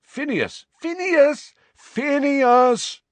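calling out a name, rising to a high, loud shout; shouting his name. Acted. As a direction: shout him awake from his sleep. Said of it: "shouted his name".

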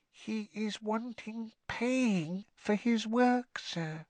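slow, softened high elf voice; confiding house elf. A narrator's reading. Winky confides Dobby's scandal to Harry, hushed squeak. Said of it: "lowered her voice by a half octave and whispered".

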